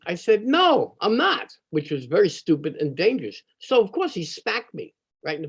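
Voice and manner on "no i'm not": high, drawn-out defiant retort; mad refusal. Retold or imitated. Twelve-year-old standing up to the bully, angrily defiant. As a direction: refuse indignantly, pitch jumping way up. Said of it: "I got so mad".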